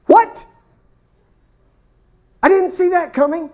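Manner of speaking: sudden high, loud what; surprised what. Acted. Preacher voicing Jesus caught off guard, shocked high shout. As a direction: blurt it out in astonishment.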